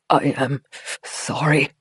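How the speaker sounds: gasping through chattering teeth; freezing, teeth chattering. A narrator's reading. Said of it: "came out as a gasp through chattering teeth".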